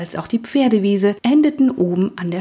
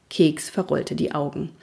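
usual speaking voice

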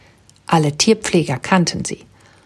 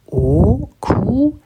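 drawn out question; 4 semitones higher than usual. A narrator's reading. Patty asks the penguin's name back, drawn out. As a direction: stretch the name into a slow, unsure question. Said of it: "fragte sie gedehnt".

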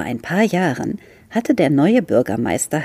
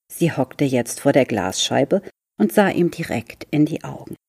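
ordinary delivery, nothing marked

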